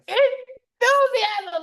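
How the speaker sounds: high, loud, stretched-out wake-up cry; exuberant. Retold or imitated. Telling how she greets every morning, exuberant. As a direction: sing out the word with huge energy. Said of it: "I'm like".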